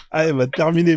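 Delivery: ordinary delivery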